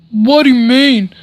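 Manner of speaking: lightly voiced indignant bully; butthurt bully. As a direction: imitate a mildly offended bully. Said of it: "he was really butthurt".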